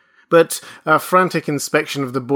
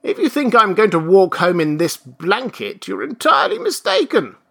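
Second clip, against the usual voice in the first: high, loud peevish refusal; protest, climbing higher as you go; indignant old man; 8 semitones higher, 7 dB louder than usual. Acted. Old man refuses to walk home so, indignant.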